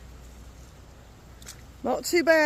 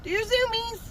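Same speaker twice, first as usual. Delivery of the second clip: high squeaky pet coaxing; egging pets on; 9 semitones higher than usual. Real, not acted. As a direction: urge the animals on, climbing higher. Egging animals on to run.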